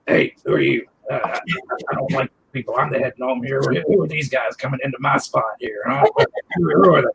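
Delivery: gruff little gnome grumbling about newcomers; grumpy gnome's voice, lightly growled. Acted. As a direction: play the territorial head gnome. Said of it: "you got the voices really good".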